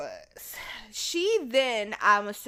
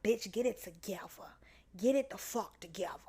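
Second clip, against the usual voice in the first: stern whisper to yourself; whispered self-scolding; 2 semitones lower, 8 dB quieter than usual. Retold or imitated. Girl to herself in the bathroom mirror, fierce whisper.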